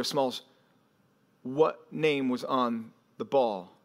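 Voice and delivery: slow, low, deliberate question; dawning dread. Retold or imitated. Boys press Smalls about the ball, slow and ominous. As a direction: ask it word by word, low and careful.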